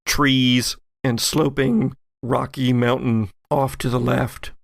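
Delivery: usual speaking voice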